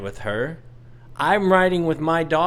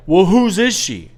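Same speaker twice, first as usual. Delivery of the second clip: demanding Karen; 3 semitones higher, 6 dB louder than usual. Retold or imitated. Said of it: "she's like".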